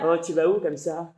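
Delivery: lightly voiced creep in a car; low leering man. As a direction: drop the voice into a slimy come-on. Man in car harassing cyclist.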